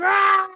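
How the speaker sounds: high, loud mock tantrum shriek; imitated toddler scream. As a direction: let out the kid's screaming wail. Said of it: "he's going".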